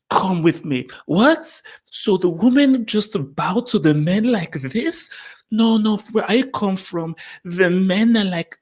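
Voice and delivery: high-pitched parody of bad acting; exaggerated, high mock-dramatic voice; mocked film dialogue. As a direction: ham up a naive heroine's lines.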